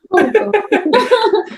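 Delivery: risa. Real, not acted. Se ríe del apagón.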